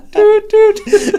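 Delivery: very high, loud laughing beeps; reversing beep. Retold or imitated. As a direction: squeal like a truck reversing. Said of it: "sound of the truck reversing".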